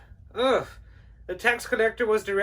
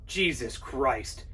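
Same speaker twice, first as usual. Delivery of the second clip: muttered aside; 9 semitones lower, 7 dB quieter than usual. Acted. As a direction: grumble to yourself once he's gone. Muttering after he leaves.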